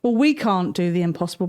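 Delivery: plain reading voice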